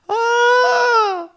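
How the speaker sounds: loud, very high "ah"; imitated child's toy-attack squeal; high mock shriek. Imitates four-year-old with toy.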